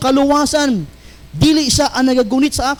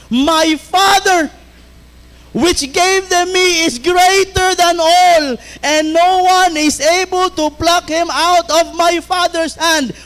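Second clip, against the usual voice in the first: higher, slow and proclaimed; slow scripture proclamation, raised pitch; ringing preaching; 5 semitones higher, slower than usual. Acted. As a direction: proclaim each phrase to the whole hall. Scripture line, first ringing take.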